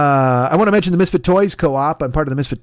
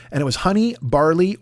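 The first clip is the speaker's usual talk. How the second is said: usual speaking voice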